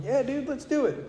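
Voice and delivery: mock-eager agreement. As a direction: friend invites him to drink, eager reply. Eager reply to drinking invite.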